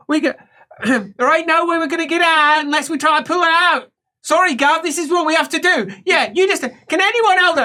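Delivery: high-pitched, pushy helper voice; harried helper. Acted. Helper explaining the procedure to Gav, acted harried voice. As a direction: talk over a struggling patient. Helpers argue with stuck patient.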